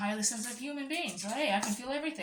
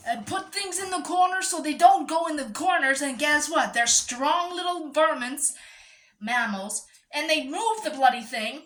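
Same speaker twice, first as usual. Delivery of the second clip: exasperated rant; 5 semitones higher, 7 dB louder than usual. Real, not acted. Exasperated rant about ferrets.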